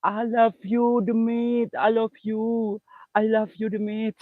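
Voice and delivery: teasing a ghost with a high sing-song I love you; high mock sweet call. Calls out to the ghost.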